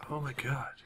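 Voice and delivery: whisper, barely breathing; a tense, frightened whisper; scared whisper. Real, not acted. Hiding from monster.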